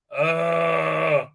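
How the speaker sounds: long exasperated "ugh"; disgusted groan. Real, not acted. Disgust at the show.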